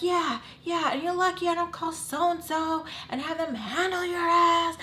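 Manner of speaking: high, heated retold threat; angry threat. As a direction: warn him off, fired up. Threatening him in the driveway.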